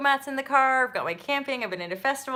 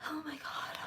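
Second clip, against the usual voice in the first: breathless whispered oh my God; stunned hushed gasp of words; shocked whisper; 6 semitones higher, 14 dB quieter than usual. Retold or imitated. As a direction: whisper it in disbelief. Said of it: "And I was just like".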